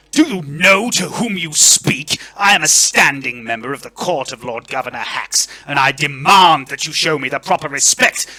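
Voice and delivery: loud, outraged aristocrat; high-pitched indignant bluster; Lord Verence. Acted. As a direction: pull rank at full volume. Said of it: "Lord Verence says".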